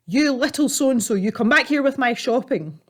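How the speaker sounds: put-on high, angry old man yell; angry shout. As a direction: yell after the thief. Robbed old man yells.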